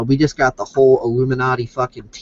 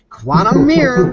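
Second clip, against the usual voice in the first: emphatic; 9 semitones higher, 6 dB louder than usual. Pretend. Punchline declaration.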